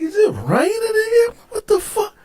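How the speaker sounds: high-pitched, baffled disbelief; bewildered outcry. Retold or imitated. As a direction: re-enact waking up totally confused. Retold waking confusion in cell.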